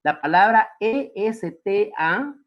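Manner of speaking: "E S T A" spelled slowly, letter by letter; spelling it out. Shown as a demonstration. Teacher spells the word for students, slow letters. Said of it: "tiene tres significados".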